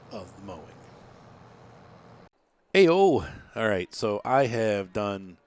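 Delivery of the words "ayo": loud cheerful call out; excited shout. Real, not acted. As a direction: burst in with a hyped greeting. Loud greeting on return.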